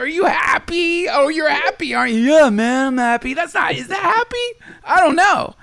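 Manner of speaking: high, strained mock shout; sarcastic high-pitched challenge; mocking cry. Real, not acted. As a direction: taunt the idea of being happy. Mocking the hedonist's happiness.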